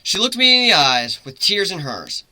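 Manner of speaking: ordinary delivery, nothing marked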